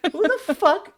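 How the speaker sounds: high, loud indignant outburst. Real, not acted. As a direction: protest in disbelief. Indignant at hard question.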